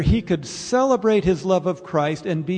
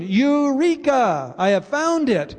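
mock shout of discovery; 5 semitones higher than usual. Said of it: "you're shouting".

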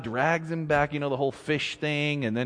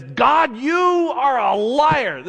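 higher, louder, slow accusation; accusing Jeremiah; 9 semitones higher, 5 dB louder, slower than usual. Retold or imitated. Jeremiah accusing God of lying, angry. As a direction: hurl the charge at God. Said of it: "Jeremiah says".

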